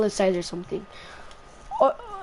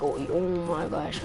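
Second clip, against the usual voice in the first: low, soft retold disbelief; muttered dismay; 7 semitones lower, 8 dB quieter than usual. Student getting beat up, quietly dismayed. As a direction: drop it low, under your breath.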